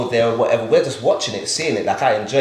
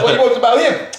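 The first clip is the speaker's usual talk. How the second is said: high loud mock disbelief; incredulous boast; 13 semitones higher, 6 dB louder, faster than usual. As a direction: scoff at how easy it sounds, hammed-up. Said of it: "I was like".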